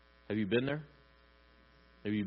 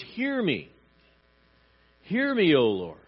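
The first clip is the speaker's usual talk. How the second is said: high, loud plea to God; crying out; 5 semitones higher, 5 dB louder than usual. Retold or imitated. Preacher voices David begging God to listen, crying out. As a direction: call out urgently, voice lifted high. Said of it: "As he's crying out".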